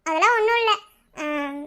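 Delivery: ordinary delivery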